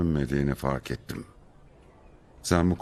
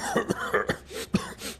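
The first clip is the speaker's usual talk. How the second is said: short burst of coughing; coughing fit; 3 dB quieter than usual. Acted. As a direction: cough as if ill with pneumonia. Sick colleague coughing on arrival.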